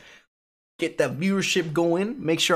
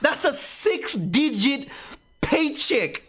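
high, hyped-up and amazed; excited; 11 semitones higher than usual. Real, not acted. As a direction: sell him the dream, wide-eyed. Hyping up Luis.

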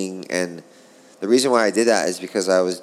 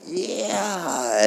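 mock cheer; 10 semitones higher, 3 dB quieter than usual. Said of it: "all the Brazilian".

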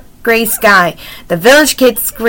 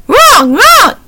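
two loud, very high bird squawks; raven croak; 12 semitones higher, 4 dB louder than usual. A narrator's reading. Raven confirms its name to Annie, croaking high. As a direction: imitate a raven calling out. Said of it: "croaked the raven".

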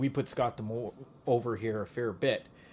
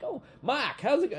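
put-on southern accent; 13 semitones higher, 4 dB louder than usual. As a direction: lightly do a southern wrestling manager saying hello. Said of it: "he's got that southern accent".

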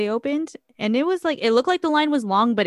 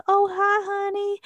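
high-pitched put-on character voice; Sad Eye Joe; 12 semitones higher than usual. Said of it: "He goes".

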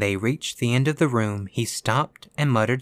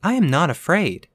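higher, louder firm declaration; 7 semitones higher, 5 dB louder than usual. A narrator's reading. Virginia standing up to the ghost's warning, firm. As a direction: state it with firm resolve. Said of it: "she said firmly".

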